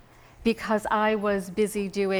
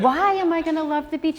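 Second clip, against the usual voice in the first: higher, eager question; curious question; 8 semitones higher, 5 dB louder than usual. Retold or imitated. Asking the beach girl why, higher and curious. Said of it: "And I said".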